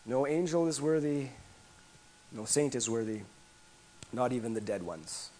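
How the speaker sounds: normal talking voice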